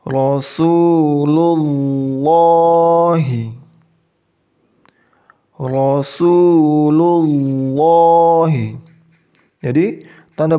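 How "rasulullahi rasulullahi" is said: Rasulullahi, very slow, the "ll" thick and held; slow, louder Quran chant of Rasulullahi; heavy lam. Shown as a demonstration. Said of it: "Seperti ini lagi".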